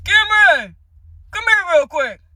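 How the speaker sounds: loud, high call up the stairs; dad calling out. Retold or imitated. Dad calling his son upstairs, loud and high. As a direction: bellow the name like a dad.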